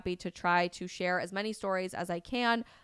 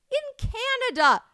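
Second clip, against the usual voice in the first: much higher, slower, stunned repeat of Canada; incredulous disbelief; 9 dB louder, slower than usual. Real, not acted. Vlogger marveling that this happens in polite Canada, incredulous. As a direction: let Canada climb way up in disbelief.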